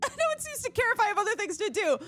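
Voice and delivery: exaggerated sarcastic impression; mocking whiny voice. Streamer mocks the doctor.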